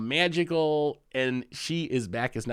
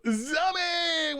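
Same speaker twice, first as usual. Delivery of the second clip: excited shout; 14 semitones higher than usual. Real, not acted. Shouting welcome to subscriber.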